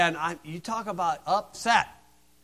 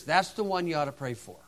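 ordinary talk, nothing marked

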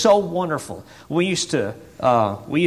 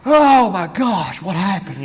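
high, slow, loud gasped-out shock, dropping off; startled; 6 semitones higher, 7 dB louder, slower than usual. Retold or imitated. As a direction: imitate a startled churchgoer. Startled congregation reacting to scream.

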